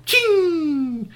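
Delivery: high ringing ching; ping like a snapped rubber band; ching sound. Acted. Marble leaving the slingshot, high ching sound.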